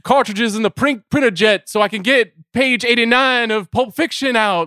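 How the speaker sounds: [name]. Retold or imitated. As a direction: put on a hammed-up rock-and-roll idol voice. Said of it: "simultaneously doing it as [name]".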